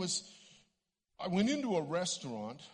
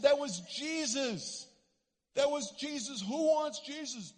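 loud, high, slow preacher's call; proclaiming to a crowd; 10 semitones higher, 6 dB louder than usual. Retold or imitated. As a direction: call it out across a basketball court. Retold street preaching to a crowd.

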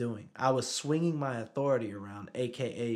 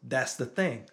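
ordinary delivery

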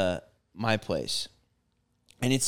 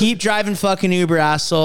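retold insult; 4 semitones higher, 6 dB louder than usual. Parting insult to driver.

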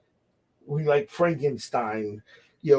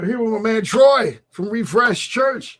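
raised, louder radio host intro; higher, louder on-air announcer voice; 7 semitones higher, 7 dB louder than usual. Real, not acted. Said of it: "Did you hear my radio voice kick in?".

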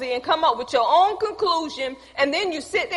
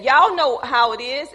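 usual speaking voice

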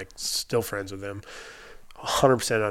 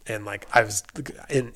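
normal talking voice

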